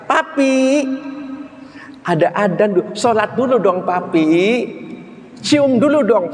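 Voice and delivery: high coaxing wife voice, calling her husband; wife calling sweetly. Acted. Istri merayu suami saat adzan. (istri merayu suami dengan suara tinggi manja)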